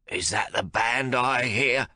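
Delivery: lightly voiced cranky old man, slow; an impatient old father; querulous old man asking. A narrator's reading.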